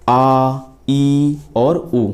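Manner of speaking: आ, ई and उ each held long and slowly; long vowels drawn out. Shown as a demonstration. Teacher showing the long Hindi vowels, drawn out. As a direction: stretch every vowel to show its full length. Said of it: "दीर्घ स्वर की संख्या होती है वो तीन".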